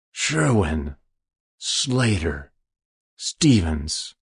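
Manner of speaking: names read off one by one to himself, each falling; drawn-out muttering through a list; muttered. Acted. Stranger leafing through his papers, muttering names. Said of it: "he muttered".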